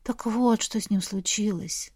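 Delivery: ordinary delivery, nothing marked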